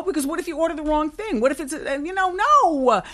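high, fretting what-if voice; fussy worry. Retold or imitated. Herself mocking her fussy worry, voiced high and fretting. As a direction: act out a control freak fretting.

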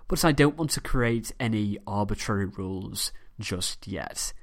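ordinary delivery

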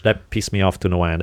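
normal talking voice

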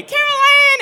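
very high, loud scream of Caroline; frantic scream. Retold or imitated. Poltergeist mom screaming for her daughter in the hallway, loud scream. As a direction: scream her name in panic. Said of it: "when the mom runs down".

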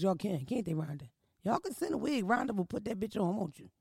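ordinary delivery

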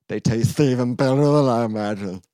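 loud put-on voice; hammed-up loud rapture; overwhelmed Toad. A narrator's reading. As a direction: belt it out, overcome by delight.

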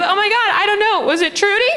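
very high, flustered mom impression; put-on panicky high mom voice; mom's voice. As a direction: imitate mom flailing for an answer, way up high. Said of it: "And she goes".

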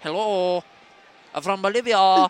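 badly faked South American accent; attempted Bolivian accent. Podcaster attempting a South American accent for a laugh, mock Bolivian accent. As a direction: put on a clumsy Bolivian accent. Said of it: "do a South American accent".

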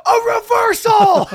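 very high, loud shout; announcer shout. Retold or imitated. Announcer calling a puke as a big play, very high and loud. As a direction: call it like a big sports play. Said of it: "the announcer goes".

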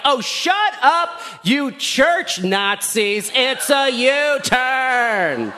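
high, slow, laughing mock yell at traffic. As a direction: drag it out, half laughing, like yelling out of the car window. Yelling at honking traffic.

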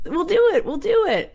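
acted-out eager insistence; undeterred eagerness. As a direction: push on brightly despite the refusals. Brian undeterred by rejections.

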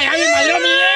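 high yelled complaint over a stolen idea; hammed-up shout of a ruined idea; mock outrage shout. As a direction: yell it up high like you've been robbed. Repeats it shouting.

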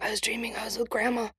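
unvoiced whisper of a man just woken from a dream. Brother telling his wife about the dream, in a whisper. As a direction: breathe it like he's still half asleep.

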